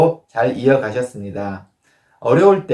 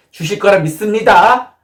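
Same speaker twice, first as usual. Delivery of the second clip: insistent mock prayer, higher and louder; presumptuous prayer; 6 semitones higher, 5 dB louder than usual. Believer demanding money from God, loud insistent certainty. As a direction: declare it as a demand, rising at the end. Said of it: "이런 의심이 아닙니다".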